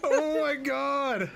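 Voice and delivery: high, drawn-out cry of disbelief; startled, long-held outburst; shocked exclamation. Real, not acted. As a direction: stretch it out high and slow, aghast. Startled by game, amid laughter.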